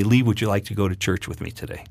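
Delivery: retold gentle wife asking her hungover husband to church; gently asking. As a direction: ask softly, like coaxing a sleepy spouse. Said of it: "she said very gently to me".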